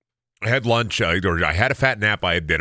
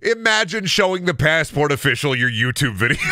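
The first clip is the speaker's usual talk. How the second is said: can barely get it out for laughing; giggling retelling, voice up high; 14 semitones higher than usual. Real, not acted. Laughing at own story.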